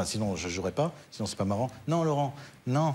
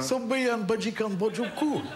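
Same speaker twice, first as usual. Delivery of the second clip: higher, lightly voiced tough guy; a hint of a movie tough guy, higher; De Niro voice; 8 semitones higher than usual. Shown as a demonstration. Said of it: "faites De Niro, là".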